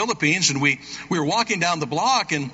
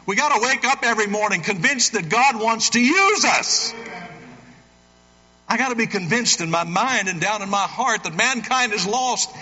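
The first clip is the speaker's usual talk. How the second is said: preaching; 5 semitones higher than usual. Real, not acted. Preacher urging the church to be convinced, preaching at full force. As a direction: drive the call home to the congregation. Closing exhortation.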